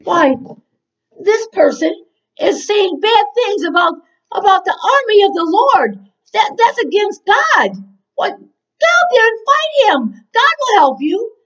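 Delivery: high-pitched indignant exhortation; rally them hard, voice pushed up; impassioned urging. Acted. Acting David urging brothers to fight.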